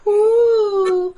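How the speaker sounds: high, loud whoop of awe; joyful cry "whoo". Real, not acted. Woman recalling seeing the Lord, awed high whoop. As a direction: let out a cry of wonder at the vision. Overwhelmed telling her vision.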